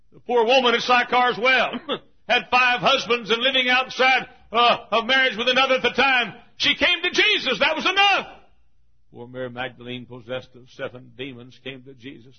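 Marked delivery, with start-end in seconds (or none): 0.1-8.3 high, shouted altar call; full-force preaching. Real, not acted. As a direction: cry out the invitation to the crowd.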